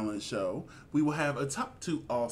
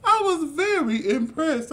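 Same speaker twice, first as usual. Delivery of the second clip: excited; 11 semitones higher, 5 dB louder than usual. Retold or imitated. Retelling own reaction, emphatic.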